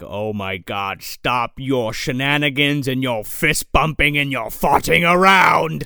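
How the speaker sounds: low, slow, hammed-up villain scolding; Pinhead. Demon telling off the fooling-around heroes, mock-grand scolding.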